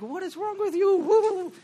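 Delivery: exasperated disbelief. Retold or imitated. Pastor's exasperated reply to questioner.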